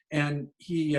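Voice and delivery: ordinary talk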